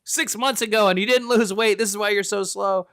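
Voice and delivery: hammed-up exasperated telling-off; mock angry trainer. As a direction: imitate a mad trainer chewing out a trainee. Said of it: "when he's mad".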